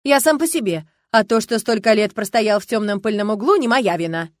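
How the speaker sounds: loud, huffy self-defence; pouting. A narrator's reading. Youth defends himself with arms crossed, pouting. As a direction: protest with a sulky pout. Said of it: "Юноша прелестно надулся".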